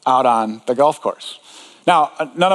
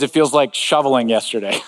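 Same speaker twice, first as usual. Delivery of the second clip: ordinary delivery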